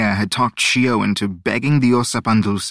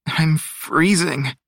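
slow, higher, grumpy complaint; grumbling; 6 semitones higher, much slower than usual. A narrator's reading. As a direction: grumble it out slowly. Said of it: "he grumbled".

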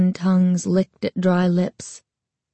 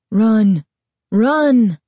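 loud urgent shout; 2 semitones higher, 5 dB louder than usual. Acted. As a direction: call out for her to run. Shopkeeper calling out warning.

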